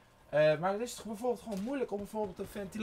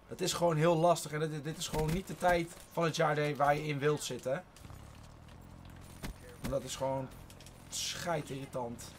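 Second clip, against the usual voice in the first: usual speaking voice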